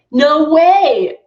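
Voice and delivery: loud, high American "No way!"; mock American exclamation; American accent. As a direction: burst out in a put-on American accent. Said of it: "jokes or things in American accent".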